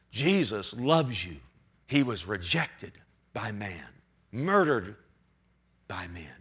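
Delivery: dropping low and soft to a whisper; hushed. Real, not acted. Preacher hushing the church about Jesus' rejection, soft and low. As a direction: let it fall to a near whisper.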